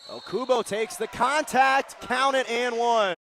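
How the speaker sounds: high, loud, thrilled basket call; real burst of commentator excitement; excited play-by-play shout. Real, not acted. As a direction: shout the big play as it happens. Live call of a scored basket.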